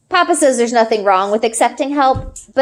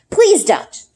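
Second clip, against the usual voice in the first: quick plea; 8 semitones higher than usual. A narrator's reading. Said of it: "I say quickly".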